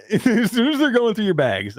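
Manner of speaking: laughing. Real, not acted. Repeats phrase while laughing.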